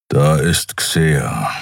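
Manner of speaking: low slow grumble; growled reply. A narrator's reading. Kero answers Endriel with a low reluctant grumble. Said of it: "brummte Kero schließlich".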